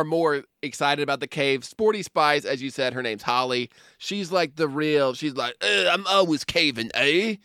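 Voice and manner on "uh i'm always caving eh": put-on lightly done English accent, eager caver; a hint of a gung-ho British caver; mock British accent. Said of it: "what part of the U K is that".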